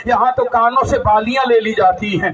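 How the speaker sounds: ordinary talk, nothing marked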